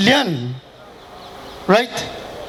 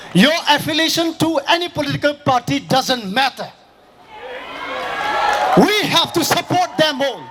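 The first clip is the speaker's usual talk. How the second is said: rally speech; 4 semitones higher than usual. Acted. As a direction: rouse the crowd, voice raised. Fiery speech to crowd at rally.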